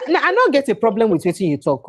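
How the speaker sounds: ordinary talk, nothing marked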